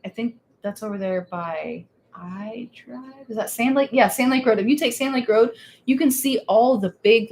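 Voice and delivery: ordinary delivery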